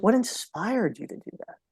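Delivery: "What" said softly, like an invitation to share; curious and inviting. Shown as a demonstration. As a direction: ask it openly, wanting to hear their story. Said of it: "What's about sharing".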